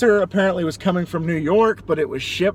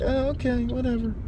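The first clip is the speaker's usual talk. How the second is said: mock indifference; 9 semitones higher, 5 dB quieter than usual. Mock underwhelmed gift reaction.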